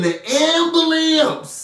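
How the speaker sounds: "ambulance" said high as a comic "AM-buh-lamps"; comic mispronunciation. Pretend. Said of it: "I know how to say ambulance, but".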